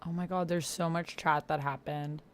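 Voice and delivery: ordinary delivery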